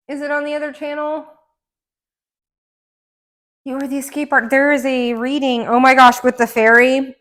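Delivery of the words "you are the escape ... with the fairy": usual speaking voice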